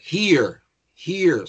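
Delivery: "here" as one syllable with a long ee; pronunciation of here. Shown as a demonstration. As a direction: model "here" singly, sounding like "heer". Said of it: "la pronunciación es muy idéntica".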